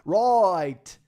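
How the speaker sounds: booming, drawn-out fish and chip ad in a mock British accent; loud, high, slow hammed-up British pitchman; British character voice. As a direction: sell it in a cartoonish British accent. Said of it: "that's my character".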